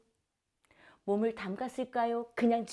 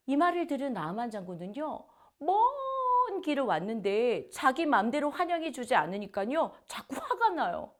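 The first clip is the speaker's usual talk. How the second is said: ordinary talk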